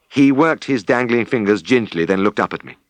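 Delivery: usual speaking voice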